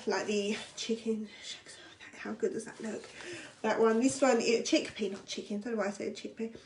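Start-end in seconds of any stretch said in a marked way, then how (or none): 2.2-3.0 whisper. Real, not acted. Admiring the chicken dish.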